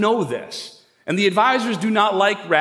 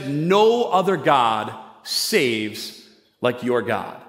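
slow, low, weighty declaration; prophetic statement; 7 semitones lower, much slower than usual. Acted. King declaring to the three men after the furnace, slow and prophetic. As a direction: draw it out solemnly, sinking lower to the last word. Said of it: "says this very prophetic statement".

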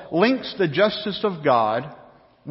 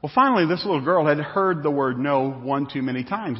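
normal talking voice

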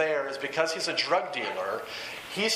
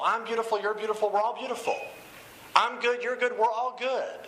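higher-pitched, singsong self-affirmation; mock pep talk; 6 semitones higher than usual. Preacher parodying self-esteem songs, mock pep talk. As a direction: chant it like an upbeat parody slogan.

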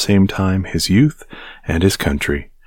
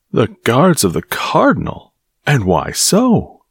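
heated question, higher pitched, slowing at the end; heated surprise at the insult; warmly indignant; 6 semitones higher than usual. A narrator's reading. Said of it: "asked Porthos warmly".

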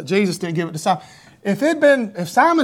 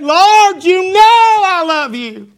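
much higher, loud, slow anguished cry; broken cry; 12 semitones higher, 8 dB louder, much slower than usual. Acted. Peter cries to Jesus, broken and desperate. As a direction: cry it out as a shattered man. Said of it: "I believe he was broken".

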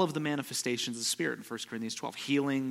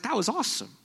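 high, delighted exclamation; thrilled preacher reacting; amazed exclamation; 13 semitones higher, 4 dB louder than usual. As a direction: burst out in wonder. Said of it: "I was like".

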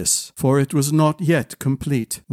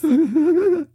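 high, noisy drawn-in breath; acted deep breath. Cower breathing along with Malady, exaggerated. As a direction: suck in air audibly. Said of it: "Deep breath".

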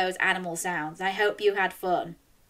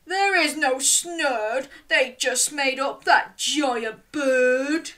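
slow, higher-pitched grumble; complaining; 5 semitones higher, slower than usual. A narrator's reading. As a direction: complain in a drawn-out character voice. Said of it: "And Lenny complained".

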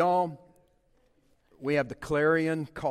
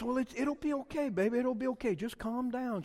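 higher-pitched reassuring voice; soothing wife; 7 semitones higher than usual. Retold or imitated. Wife reassuring her upset husband by text, soothing. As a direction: gently talk someone down. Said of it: "and then she goes".

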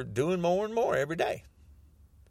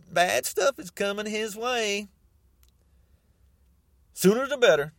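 ordinary delivery

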